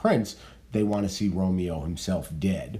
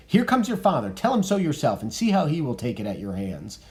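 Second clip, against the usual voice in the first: reading Lady Capulet; 6 semitones higher, 3 dB louder than usual. Acted.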